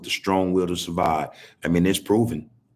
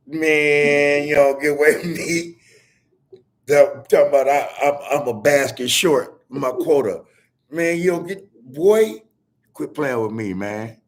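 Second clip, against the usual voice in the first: mock angry field worker; 5 semitones higher, 4 dB louder than usual. Voices an angry sharecropper.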